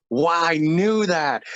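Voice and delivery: high, slow, drawn-out wow; husband's friend confirms the vision, wife answers in excited amazement. Retold or imitated. Stretch it out in delighted amazement. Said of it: "Patty's like".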